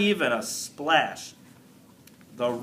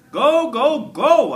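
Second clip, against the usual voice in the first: excited cheering; 9 semitones higher, 8 dB louder, slower than usual. Acted. Hamster cheers Aldo, hopping.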